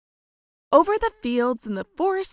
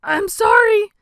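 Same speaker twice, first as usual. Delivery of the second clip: higher, louder flustered sorry; spluttering apology; 11 semitones higher, 7 dB louder than usual. Acted. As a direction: stammer it out, scared of the beast. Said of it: "he spluttered".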